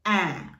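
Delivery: "A" said as a long open eh; German ä sound on "A"; A umlaut. Shown as a demonstration. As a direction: say "A" as German ä, falling tone. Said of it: "that's pronounced as".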